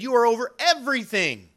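proclaiming. Retold or imitated. Pharaoh's royal proclamation.

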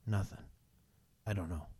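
low, very soft and defeated; hopeless. Acted. Leader everybody looks to for answers, hopeless. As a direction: sink down as if out of answers.

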